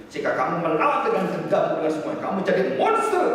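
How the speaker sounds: rising to a high, loud warning; stern preaching warning. Retold or imitated. Pastor warning a bitter youth he'll become a monster, stern preaching. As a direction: build from firm counsel to forceful admonition.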